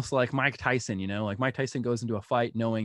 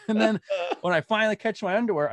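laughing; 9 semitones higher, 4 dB louder than usual. Real, not acted. Laughing while retelling underwear chase.

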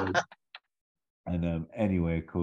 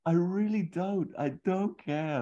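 put-on high, slow, dreamy boy voice; Toby voice; 13 semitones higher than usual. Toby telling Sarah he wants to stay, high and earnest. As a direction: go up high and earnest like a smitten kid. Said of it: "And he goes".

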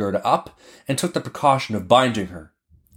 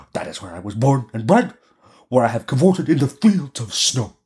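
lightly voiced reindeer, reminiscing; a hint of an animal character; 2 semitones higher than usual. Reindeer recalls where it was born, lightly voiced.

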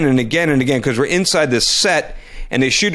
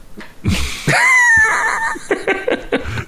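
very high burst of laughter; shrieking laugh. Real, not acted. Laughing during the show's sign-off.

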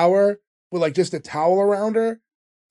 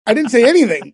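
louder defensive repeat; 5 semitones higher, 5 dB louder than usual. Real, not acted. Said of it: "Did you say Cherokee?".

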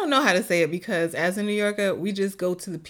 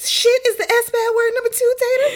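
put-on very high, loud child voice; imitating a kid; 6 dB louder than usual. Mom voicing a child explaining the bad word: very high, loud child voice. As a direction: announce it like a know-it-all kid. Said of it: "mom voicing a child".

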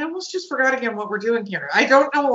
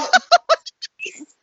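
high laughter. Real, not acted. Laughing while recomposing.

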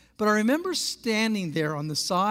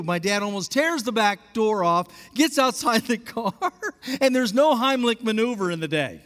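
normal talking voice

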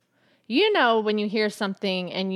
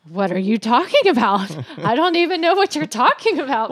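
mock baffled husband, louder; a hint of his clueless protest; mimicking husband; 4 semitones higher, 6 dB louder than usual. Wife imitating husband's confused reply, lightly louder. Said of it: "he was like".

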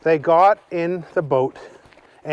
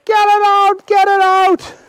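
very high, loud panicked yell; children screaming about the worm in the boat; 7 dB louder than usual. Acted. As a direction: shriek it at the worm. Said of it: "started yelling".

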